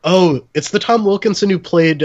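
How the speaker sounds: ordinary talk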